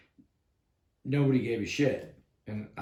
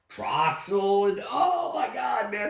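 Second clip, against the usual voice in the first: worked-up exclaiming; 9 semitones higher, slower than usual. Retold or imitated. Still worked up over the tanks.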